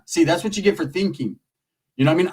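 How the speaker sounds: ordinary talk, nothing marked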